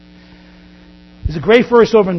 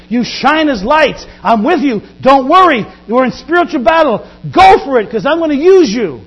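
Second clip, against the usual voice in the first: loud, high rallying charge; emphatic, rousing encouragement to the troops; Jesus; 6 semitones higher, 7 dB louder than usual. Acted. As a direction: urge them on with rising force. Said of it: "And Jesus is saying".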